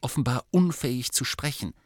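ordinary delivery

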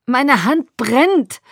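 high, drawn-out loud cry; screaming in pain. A narrator's reading. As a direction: cry out slowly in agony. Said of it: "schrie ich". (vor Schmerz aufgeschrien, laut und langgezogen)

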